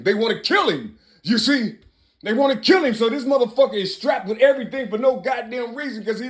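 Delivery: high-pitched, impassioned rant; worked-up passion. Real, not acted. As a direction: plead urgently at a high pitch, desperate to be understood. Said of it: "I'm just so goddamn passionate".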